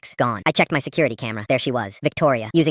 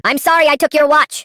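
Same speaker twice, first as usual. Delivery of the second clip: high, loud forced scream of apology; 7 dB louder than usual. A narrator's reading. Sister screaming apology at her sibling. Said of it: "Louder, she screamed it".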